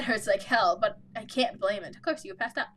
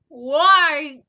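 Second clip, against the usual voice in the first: exasperated; 3 semitones higher, 10 dB louder than usual. Real, not acted. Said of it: "I don't understand people".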